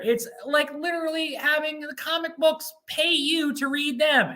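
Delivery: high, pushy salesman pitch; hard-sell the VIP program like an infomercial; mock ad read. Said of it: "impromptu advertisement done".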